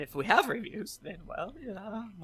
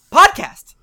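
loud, high one-word shout; shouted exclamation; 13 semitones higher, 18 dB louder than usual. Acted. Host reading out a listener's podcast review, shouted exclamation. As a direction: blurt it out at full volume.

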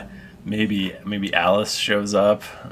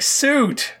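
higher scolding parent voice; stern owners; 9 semitones higher than usual. Acted. GM voicing stern owners scolding their cat, higher scolding. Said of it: "they're very stern with you".

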